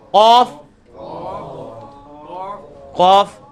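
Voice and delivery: long, heavy قاف as a model; back-of-tongue qaf. Shown as a demonstration. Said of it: "آپ اوپر تالو کے ساتھ".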